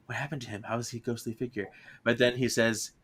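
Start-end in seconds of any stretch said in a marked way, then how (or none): 0.1-1.7 much softer, quick puzzled murmur; hushed wonder. Retold or imitated. Gino seeing his friend as a ghost, hushed and puzzled. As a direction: wonder aloud under your breath.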